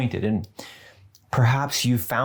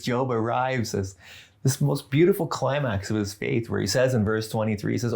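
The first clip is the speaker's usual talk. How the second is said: ordinary delivery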